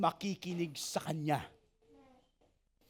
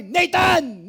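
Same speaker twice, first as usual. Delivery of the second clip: loud, high shout of the name Nathan; 5 semitones higher, 7 dB louder than usual. Shown as a demonstration. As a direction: bellow the name across the room to show what God does not do. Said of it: "hindi siya sumisigaw". (malakas na sigaw ng pangalan)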